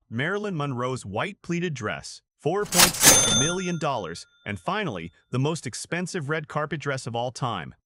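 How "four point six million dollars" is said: loud announcing. Real, not acted. Price announced.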